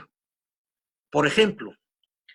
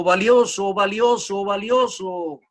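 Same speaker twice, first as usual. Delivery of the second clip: loud, higher repeats fading away; echo; 5 semitones higher, 4 dB louder, slower than usual. Retold or imitated. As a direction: throw it back like a cave echo. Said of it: "y le devuelve nuevamente el eco".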